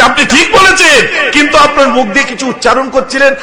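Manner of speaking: high and loud exclaiming doctor; excited doctor. Retold or imitated. Doctor telling the preacher his chant, high loud eager. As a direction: announce the discovery eagerly. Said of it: "বলছে".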